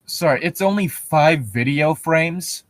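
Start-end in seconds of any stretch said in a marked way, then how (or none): none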